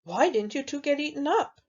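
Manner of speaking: a hint of high pig voice; third little pig. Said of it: "says the third little pig".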